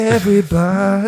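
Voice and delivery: high, slow showman call; high, drawn-out hammed-up announcer; mock announcer. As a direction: call the crowd in like a host. Announcing the band.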